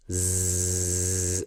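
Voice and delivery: a long buzzing Zzz like an electric shaver. Shown as a demonstration. Teacher imitating an electric shaver's buzz. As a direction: hold the buzz like a shaver humming. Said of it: "Like electric kind of sound".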